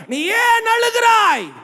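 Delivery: very high, drawn-out preacher's cry; impassioned high shout from the pulpit; shouted sermon question. Retold or imitated. Shouts the quoted question to congregation.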